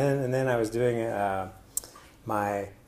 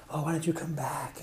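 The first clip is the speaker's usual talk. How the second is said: hushed invitation; 6 semitones higher, 5 dB quieter than usual. Retold or imitated. Imitating people inviting him.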